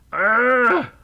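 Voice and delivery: usual speaking voice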